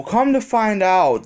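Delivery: ordinary delivery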